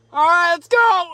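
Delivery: high and loud, slow and drawn out; cheering himself on before cutting the roof; psyched up. Real, not acted. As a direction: pump yourself up to cut. Psyching up to cut hole.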